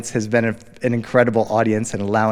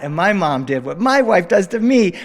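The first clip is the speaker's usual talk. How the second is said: usual speaking voice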